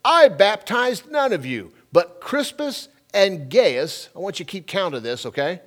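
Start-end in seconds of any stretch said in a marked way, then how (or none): 0.1-4.1 slow, high, emphatic scripture proclamation; scripture reading. Acted. Preacher reading Paul's words to the congregation, slow and ringing scripture reading. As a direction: proclaim the verse loudly, drawing out the key words.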